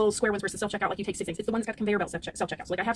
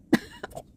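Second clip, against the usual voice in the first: short exasperated laugh. Real, not acted. Exasperated laugh mid story.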